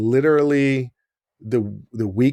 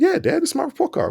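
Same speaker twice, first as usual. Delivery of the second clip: much higher, playing dumb; innocent kid. Son insists to dad it's his report card, high and innocent. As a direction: squeak it out like a kid caught lying.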